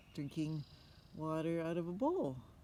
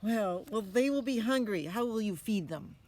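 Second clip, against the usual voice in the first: higher, louder skeptical mother; questioning mother; 5 semitones higher, 10 dB louder than usual. Acted. Mother challenging her son, higher and louder. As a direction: press him like a doubtful mom.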